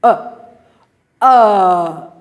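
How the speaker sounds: uh held long and open; drawn out neutral uh; schwa demo. Shown as a demonstration. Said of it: "That's the same sound".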